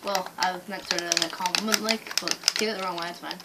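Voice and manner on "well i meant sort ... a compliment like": ordinary talk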